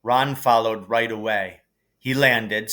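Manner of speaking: usual speaking voice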